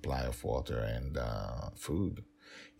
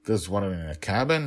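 higher, quick, incredulous brush-off; dismissive father; 4 semitones higher, 7 dB louder than usual. Acted. Dragon Father dismissing the nanny's worry, incredulous and quick. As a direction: wave her worry away, fast.

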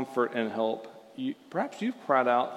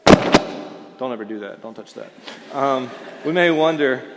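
usual speaking voice

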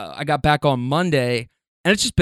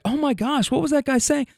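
alarmed cousin; 10 semitones higher than usual. Retold or imitated.

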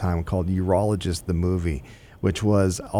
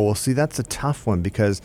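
usual speaking voice

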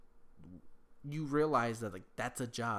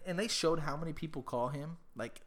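ordinary talk

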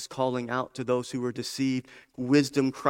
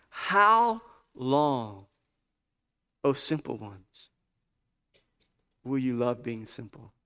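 slow, pleading recitation; slower than usual. Acted. Preacher voicing Wisdom's question to the simple ones, slow plea. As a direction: let each phrase hang, then drop away.

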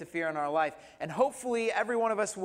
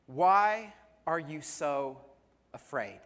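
"Why are you so afraid?" drawn out slowly, with "afraid" dropping low; "Why are you so afraid?" said slowly and weightily; slow emphatic question; 4 semitones lower, slower than usual. Shown as a demonstration. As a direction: let each word of "Why are you so" hang before "afraid". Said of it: "That question is".

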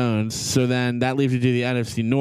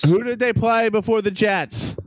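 raised voice; 7 semitones higher than usual. Real, not acted. Repeating a question his friend keeps dodging, raised voice. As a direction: press the question again, higher and pushier. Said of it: "Don't yell at me".

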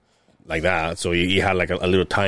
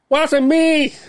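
high, loud denial; mock Howie denial; 4 dB louder than usual. Coworker calling from the back, high denial. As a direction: pitch it up like a guilty coworker.

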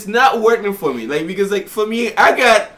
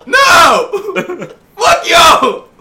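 high, loud, indignant shout; angry outburst; 9 semitones higher, 5 dB louder, much slower than usual. Real, not acted. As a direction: yell it in comic outrage. Comic angry rant at the others.

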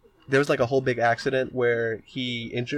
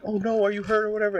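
mock worried; 8 semitones higher, faster than usual. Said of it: "she's like".

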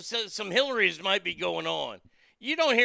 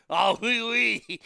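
bad French accent; 3 semitones higher, 4 dB louder than usual. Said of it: "a really shitty French accent".

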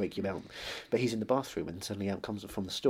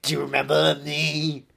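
slow, high, loud rock-star voice; [name] impression; 5 semitones higher, 9 dB louder, much slower than usual. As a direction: drag it out like a nu-metal frontman. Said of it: "I'm [name]".

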